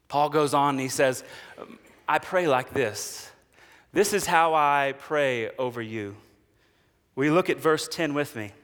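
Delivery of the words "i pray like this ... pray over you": gentle quoting. Acted. Paul quoted tenderly.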